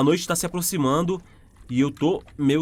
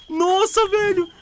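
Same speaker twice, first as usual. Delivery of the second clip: shocked; 11 semitones higher, 3 dB louder, much slower than usual. Real, not acted. Reacting to fast monster.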